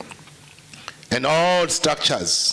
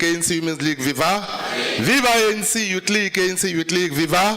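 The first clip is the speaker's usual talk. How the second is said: rally call-and-response; 6 semitones higher than usual. Real, not acted. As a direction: pump up the crowd, slogan after slogan. Rally leader leads viva chants.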